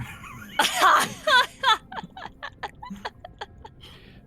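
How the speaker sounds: high-pitched cackling laugh, trailing off; high burst of laughter. Real, not acted. Player tickled by getting smaller, high helpless laughter. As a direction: burst out laughing high, then let it fade. Laughing at own size joke.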